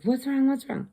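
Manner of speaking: high, urgent worried asking; quick, alarmed repeat. Retold or imitated. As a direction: sound startled by her scream. On phone, daughter screaming.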